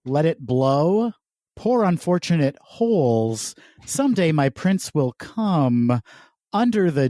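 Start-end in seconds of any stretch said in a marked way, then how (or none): none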